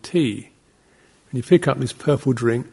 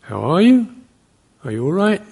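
"you" and "right" swing up high; questioning rise; 6 semitones higher, 5 dB louder than usual. Shown as a demonstration. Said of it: "That's a question".

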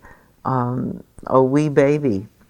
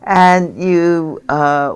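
ordinary talk

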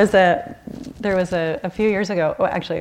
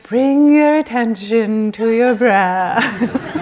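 slow, higher teacher voice through a laugh; mocking meditation teacher; 4 semitones higher, 6 dB louder, much slower than usual. Daughter mocking her mother's meditation instructions, slow and laughing. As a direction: parody a calm instructor, slowly, laughing. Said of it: "she likes to imitate me".

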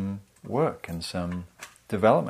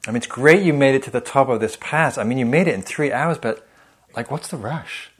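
higher and louder, a mocking needle ending fast; sarcastic critic; 6 semitones higher, 6 dB louder than usual. Inner critic mocking Mister Mindfulness for rushing, high sarcastic jab. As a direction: needle sarcastically, then rattle off the end. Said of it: "argue both sides".

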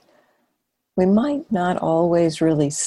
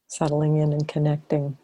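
guided meditation. Real, not acted. Teacher leading a sitting to relax and notice awareness, guided meditation. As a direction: invite them gently into awareness, phrase by phrase.

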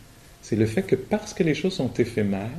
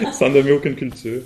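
laughing; 3 semitones higher, 7 dB louder than usual. Real, not acted. Joking aside after teasing remark.

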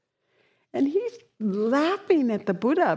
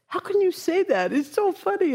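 scoffing question, pitched higher; mocking disbelief; 9 semitones higher than usual. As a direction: sneer at the Buddha's claim.

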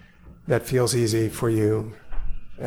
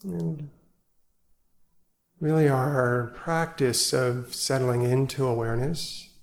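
guided meditation; 4 dB quieter than usual. Acted. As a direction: ease them into stillness, gently and unhurried. Leads evening sit meditation.

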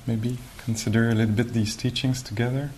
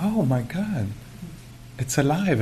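acted amazement; 5 semitones higher than usual. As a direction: re-enact marvelling at a living creature. Acts amazement at seeing animal move.

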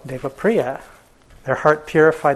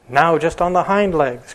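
higher and louder royal command; cried out; 4 semitones higher, 4 dB louder than usual. Acted. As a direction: call out the order across the crowd. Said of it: "cried the king".

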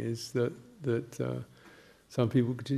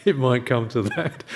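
laughing; 7 dB louder than usual. Retold or imitated. Quoting biker, laughing at joke.

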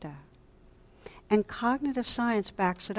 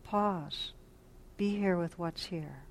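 soft, tender inner voice, quieter; loving presence; 7 dB quieter than usual. Retold or imitated. Loving presence calling the listener back, soft and tender. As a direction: gently coax attention back like a loving friend. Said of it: "the call of loving presence that says".

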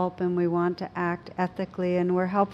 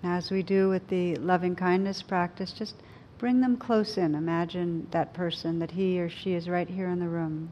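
guided meditation. Acted. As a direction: gently guide them inward.

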